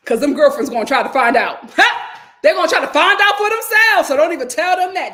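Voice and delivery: loud, laughing and high; gossiping laugh-filled caution; laughing warning. Real, not acted.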